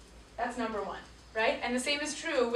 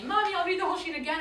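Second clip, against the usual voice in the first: put-on hurried, higher-pitched pleading of an upset little girl; upset child; 7 semitones higher, 4 dB louder, faster than usual. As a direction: mildly imitate a child's frantic protest. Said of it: "screaming and crying, and she was so mad".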